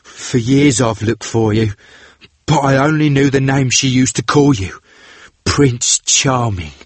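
grim vengeful sailor voice. A narrator's reading. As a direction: spit out the long-held grudge. Vengeful brother threatens.

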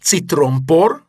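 loud, high, drawn-out password; said aloud. A narrator's reading. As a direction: call the password out loud and slowly. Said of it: "szólt fennhangon". (fennhangon, magasan, elnyújtva)

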